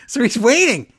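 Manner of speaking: bursting with amusement; a touch of giddy glee; excited. Real, not acted. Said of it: "excited aside".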